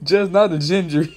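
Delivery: laughs through the words; can't hold back the laugh. Real, not acted. To the stream about a viewer, laughing.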